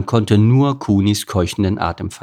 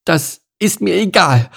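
high-pitched, horrified retort; dismayed cow character, pitch jumping up; 10 semitones higher, 4 dB louder than usual. A narrator's reading. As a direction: protest with a shudder of horror. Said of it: "erwiderte Kuni und schauderte noch einmal vor Entsetzen".

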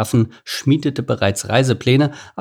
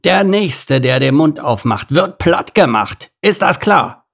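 raised, high-pitched threat; angry scolding; 5 semitones higher, 4 dB louder than usual. A narrator's reading. Rhino threatening the chatterers, angry. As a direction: scold hard, ending on a sharp demand. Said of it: "schimpfte Lord Nase".

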